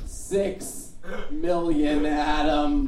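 loud, drawn-out mother's wail; lamenting mother. Acted. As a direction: wail it slowly at your son to pile on guilt. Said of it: "To which she replies".

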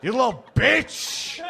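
a mock-offended toddler takes back his beer; two-year-old impression. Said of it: "That's my impression of a two-year-old".

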